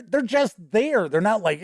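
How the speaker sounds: usual speaking voice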